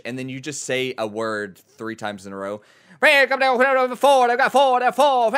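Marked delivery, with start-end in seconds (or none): 3.0-5.4 high, loud rapid auction chant; auctioneer voice. Shown as a demonstration. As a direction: rattle it off like an auctioneer. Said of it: "the auctioneer voice is so easy".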